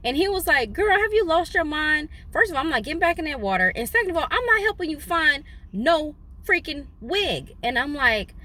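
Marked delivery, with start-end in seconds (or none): 0.7-7.5 incredulous. Retold or imitated. Friend's incredulous refusal.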